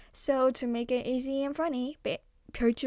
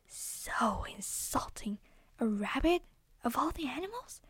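muttered grumble; 2 semitones higher, 3 dB quieter than usual. Acted. Deer grumbling to itself walking away.

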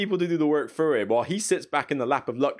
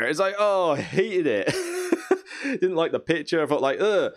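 ordinary talk

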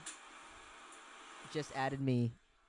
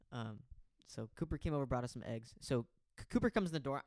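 normal talking voice

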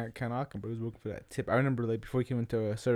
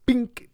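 a sudden high, louder bink like a bump; high bink sound; 11 semitones higher, 4 dB louder than usual. Retold or imitated. Friend retelling the crash, a high sharp bink. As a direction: mimic the thud of hitting your own head. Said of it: "I was just like".

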